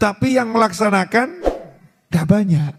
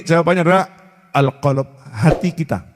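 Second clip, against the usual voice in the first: usual speaking voice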